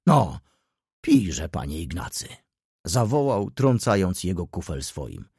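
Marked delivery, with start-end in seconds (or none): none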